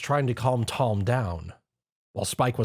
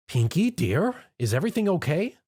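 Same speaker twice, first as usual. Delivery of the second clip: higher, gentle call through a door; a motherly baker checking on her girl; 7 semitones higher than usual. Acted. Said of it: "she asked as she knocked".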